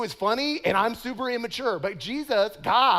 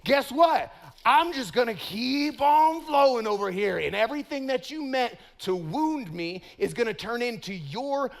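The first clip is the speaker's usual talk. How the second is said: full-force preaching; 3 semitones higher than usual. Acted. Pastor rousing the youth to not retaliate, impassioned preaching. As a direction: drive it home to the congregation.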